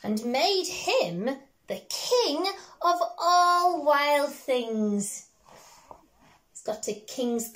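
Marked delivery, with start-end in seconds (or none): 0.0-5.3 put-on slow, lilting read-aloud to a small child; storybook reading. Parent reading a picture book to a child, gently lilting.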